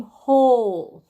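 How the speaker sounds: "Hole" with a loud, stretched long O. Shown as a demonstration. Teacher shows what magic E does, stretched long O. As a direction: draw out the O in "Hole" as its letter name. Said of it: "makes that O say its name".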